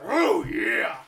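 heroic rallying shout; wrestling promo shout. Shown as a demonstration. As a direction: bellow it like a wrestler hyping a fight. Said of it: "You just gotta make sure that you're getting ready to fight someone".

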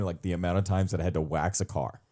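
normal talking voice

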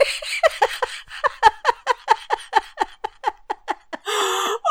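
long, high run of helpless laughter; nervous laugh. Real, not acted. Daughter after van nearly struck, long nervous laugh. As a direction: laugh off the fright, unable to stop. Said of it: "I might nervous laugh".

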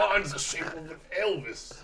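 slow, strange put-on voice; goofy slurred drawl; odd character voice. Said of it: "What the fuck was that last voice?".

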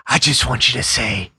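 slow, breathy whisper; Jack Palance impression. Palance telling Tim Burton on set, slow breathy whisper. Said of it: "Jack Palance story".

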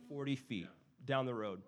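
plain reading voice

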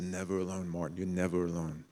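soft, tender reassurance; gentle reassurance. Retold or imitated. God quietly reassuring Martin Luther King, tender and soft. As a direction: speak it softly, like a comforting whisper to a friend. Repeats God's gentle word.

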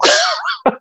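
a quick high-pitched laugh. Real, not acted. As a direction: let out a short squeal of a laugh. Laughs at the boss's answer.

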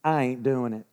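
lightly put-on Texan refusal; Texan accent. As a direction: say it like a stubborn Texan. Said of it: "I'm gonna say it in Texan".

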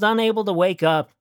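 ordinary delivery

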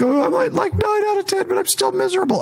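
very high, soft, whimpering voice; miserable whimper. Retold or imitated. Client still miserable after exercise.

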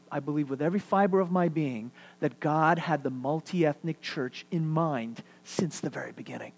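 normal talking voice

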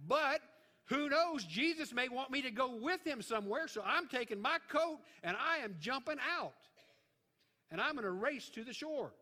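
high, loud, emphatic burst; eager resolve. Acted. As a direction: declare it boldly, ready to race off. Acting out Peter leaping from boat.

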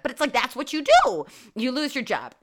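usual speaking voice

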